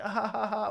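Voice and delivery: high put-on giggle; mock fan laugh. Mocking Strickland's fans, fake giddy laughter. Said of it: "MMA community that's like".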